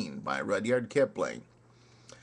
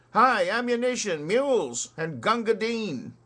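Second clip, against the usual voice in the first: high, louder battle call; shouting; 10 semitones higher, 5 dB louder than usual. Acted. Front files shouting for supplies, high battle call. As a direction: cry out down the firing line. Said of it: "you could hear the front files shout".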